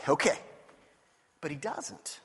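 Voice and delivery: ordinary talk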